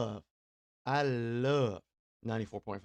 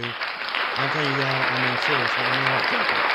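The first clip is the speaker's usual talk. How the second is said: emphatic shout; 8 dB louder than usual. Real, not acted. To the sergeant listening, loud and insistent. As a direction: hammer it home to the officer. Emphatic praise of law enforcement.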